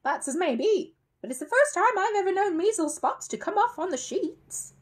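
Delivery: loud, high, sceptical retort; suspicious. A narrator's reading. Mrs Bird doubting spots found on the sheets, suspicious. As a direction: challenge the story in a high, suspicious voice. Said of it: "said Missus Bird suspiciously".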